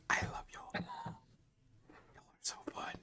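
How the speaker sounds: soft whispered glee; whispered delight. Acted. Child telling the party she loves them, whispered. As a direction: whisper it like a happy secret. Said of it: "She's like".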